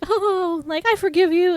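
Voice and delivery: high-pitched mock girlish gush; Sandy forgiving. Retelling a movie heroine forgiving her boyfriend, mock girlish gush. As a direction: put on a high, cooing lovestruck voice.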